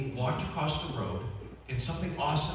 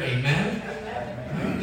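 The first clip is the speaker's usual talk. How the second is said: ordinary talk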